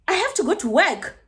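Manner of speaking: high-pitched mock rough demand. Neighbour at the window needing to leave, rough. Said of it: "You are very rough in the morning".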